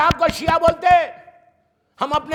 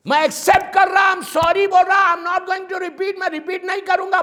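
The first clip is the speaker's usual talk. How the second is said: louder, higher begging plea; pleading confession; 6 semitones higher, 3 dB louder than usual. Acted. The sinner begs God not to shame him, pleading loudly. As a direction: plead with God with rising urgency.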